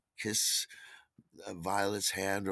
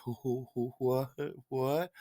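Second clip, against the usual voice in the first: put-on higher voice, baffled stammer; Michael's baffled hooting; 5 semitones higher than usual. Michael reacting to the shoes-off excuse, baffled hooting. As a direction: imitate someone hooting in disbelief.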